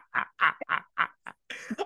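real, breathy laughing fit; helpless laugh. Real, not acted. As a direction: crack up, voiceless and wheezing. Cracks up over the volcano.

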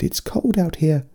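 high, slow little bear voice; small creature pleading. A narrator's reading. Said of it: "he said".

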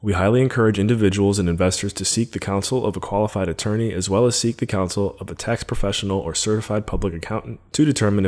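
quick, even disclaimer read; fast legal disclaimer. Acted. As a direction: rattle off the legal fine print.

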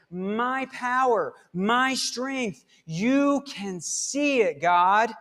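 David praising God, a touch elevated; worshipful praise. Acted. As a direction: pray it with awe and confidence. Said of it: "what does David say in worship".

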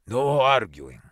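loud, curt order; commanding. A narrator's reading. Superintendent silencing the arguing men, loud command. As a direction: cut the quarrel off with authority.

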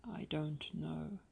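slow, breathy church whisper; slow anxious whisper between two scared people. Retold or imitated. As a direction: whisper so no one in the pew hears. Hushed exchange in church pew.

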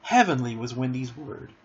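plain reading voice

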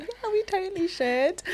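fake polite. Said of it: "I'm that person who's like".

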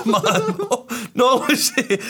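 dad laughing as he corrects his daughter; laughing protest. Retold or imitated. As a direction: protest while cracking up. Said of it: "And I'm like".

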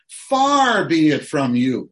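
slow, loud exclamation on "Far be it from you!"; exclaimed. Shown as a demonstration. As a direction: proclaim "Far be it from you!" with full force. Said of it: "Exclamation mark".

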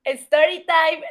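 alto y muy lento, estirando "time"; "Story time" anunciado lento y agudo, como un show; anuncio de presentador. Shown as a demonstration. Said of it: "Tienes la palabra". (lo presenta solemne, arrastrando "time")

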